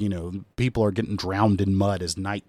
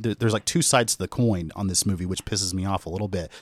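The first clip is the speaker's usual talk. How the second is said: normal talking voice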